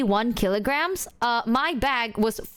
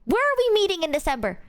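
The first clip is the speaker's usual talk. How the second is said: fast, higher-pitched pointed question; imitated exasperation; 8 semitones higher, faster than usual. Friend pointing out the December meeting, quick exasperated question. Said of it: "She's like".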